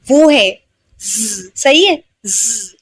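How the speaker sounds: long slow ज buzzing like flies; a drawn-out buzzing z; buzzing z sound. Shown as a demonstration. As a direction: buzz the ज like a swarm of flies. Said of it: "जैसे मक्खियां भिनभिनाती है ना".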